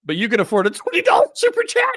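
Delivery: fast, high and loud, bursting into laughter; laughing hard. Real, not acted. Host teasing a viewer about a super chat, cracking up. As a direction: blurt it before the laugh takes over. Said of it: "I'm sorry for laughing".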